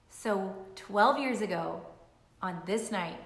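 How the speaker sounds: ordinary talk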